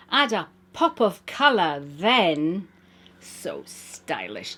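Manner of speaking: rhythmic, wry recital of verse; poem reading. Acted. Reading her clothing poem aloud, wry recital. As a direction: perform the rhymes with a knowing wink.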